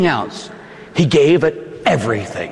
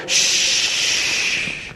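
tender soothing; 3 semitones lower, much slower than usual. Acted. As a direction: comfort a crying child. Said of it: "she'd say".

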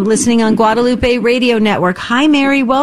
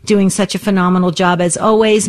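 ordinary talk

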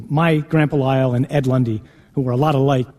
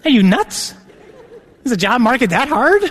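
laughing disbelief; 8 semitones higher than usual. Retold or imitated. To the friend who turns out to be the vocation director, laughing disbelief. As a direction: tease him in utter surprise.

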